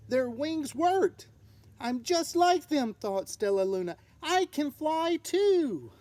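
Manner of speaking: convince herself she can fly; excited little bat; eager hope. Acted. Stellaluna thinks eagerly.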